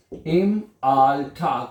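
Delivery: drawn-out very slow; slow repeat. Shown as a demonstration. Teacher repeating the phrase slowly for students. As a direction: stretch each syllable.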